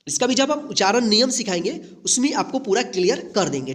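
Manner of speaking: ordinary delivery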